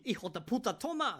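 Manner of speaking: high, loud hijo de puta, toma; excited imitation. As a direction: shout it like the YouTuber throwing. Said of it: "This is what I mean".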